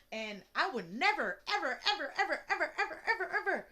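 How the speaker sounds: incredulous stacking of "ever"; rising emphasis. Real, not acted. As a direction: build the "ever, ever" chain upward.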